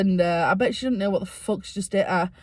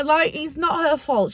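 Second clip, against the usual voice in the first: ordinary talk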